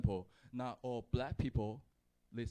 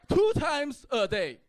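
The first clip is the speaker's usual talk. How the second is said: high, very loud comic shout; emphatic shouting; 11 dB louder than usual. Acted. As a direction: yell it out in comic insistence. Emphatic shouted punchline to audience.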